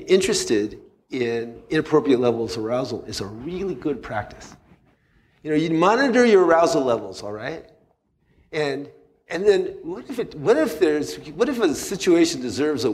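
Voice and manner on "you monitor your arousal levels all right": ordinary talk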